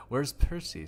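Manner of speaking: ordinary delivery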